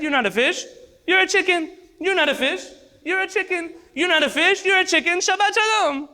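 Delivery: high, sing-song mock sprinkling chant; triumphant mock blessing over the fish; mock priestly blessing. Man mimics priest's sprinkling chant.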